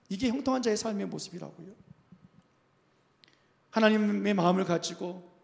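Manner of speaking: ordinary talk, nothing marked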